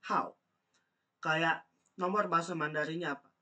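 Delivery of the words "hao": hao said loud with a sharp falling fourth tone. Shown as a demonstration. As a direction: drop hao hard from high to low. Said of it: "Kalau nomor itu nada empat".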